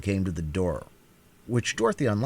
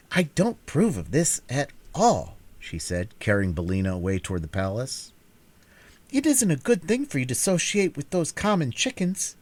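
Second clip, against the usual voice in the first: put-on disapproving girl; 4 semitones higher than usual. Dorothy lectures her hen.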